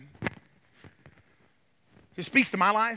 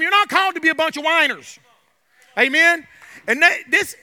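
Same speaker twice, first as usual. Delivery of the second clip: loud, driving sermon delivery; fervent preaching; 10 semitones higher, 12 dB louder than usual. Real, not acted. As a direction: preach it with mounting conviction, punching key words. Preaches against whining.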